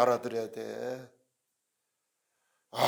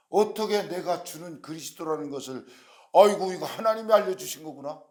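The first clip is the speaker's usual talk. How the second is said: delighted amazement, rising higher and louder; 4 semitones higher, 6 dB louder than usual. Acted. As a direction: marvel at it, voicing an overjoyed teacher. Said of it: "기쁘셔가지고". (기뻐서 감탄하는 목소리)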